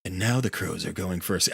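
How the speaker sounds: hushed wildlife documentary voice-over; a light nature documentary narrator; David Attenborough. Retold or imitated. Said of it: "there should have been David Attenborough".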